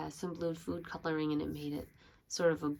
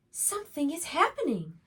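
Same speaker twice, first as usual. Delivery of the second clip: a hint of a curious little owl, higher; lightly voiced little owl; 9 semitones higher than usual. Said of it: "he thought".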